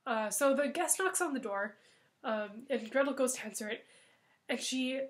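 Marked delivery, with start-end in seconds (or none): none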